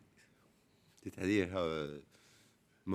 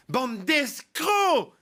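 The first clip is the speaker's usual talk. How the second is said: angry shout; 6 semitones higher, much slower than usual. Acted. Angry caller bursts out.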